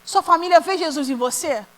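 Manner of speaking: usual speaking voice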